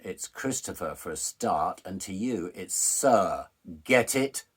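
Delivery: loud, deliberate master building to a shout; domineering correction. Retold or imitated. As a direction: correct him forcefully, hammering the final words. Master corrects client's form of address.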